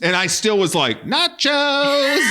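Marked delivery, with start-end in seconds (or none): 1.0-2.2 high gleeful shout; squealed food cheer; excited nachos cry. Retold or imitated. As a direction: blurt it out with craving.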